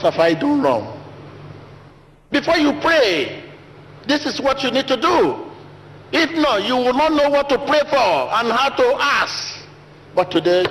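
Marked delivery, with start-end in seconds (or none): 2.3-9.6 high-pitched urgent preaching; high emphatic preaching. Real, not acted. Preacher urging the congregation to prepare, high-pitched. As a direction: lift the voice high to stress it.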